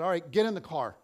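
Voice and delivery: loud, firm command, no arguing; firm mom order. Retold or imitated. Mom ordering her hurt son into the car, loudly firm. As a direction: snap an order to get moving. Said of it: "So my mom finally said".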